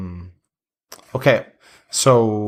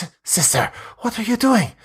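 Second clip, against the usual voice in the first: mock hissing sister; 10 semitones higher than usual. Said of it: "hisses at her and goes".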